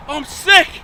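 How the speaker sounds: high, loud excuse; re-enacted pleading shout. Caught man excusing himself to the owner, acted pleading shout. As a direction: plead it loudly across the yard.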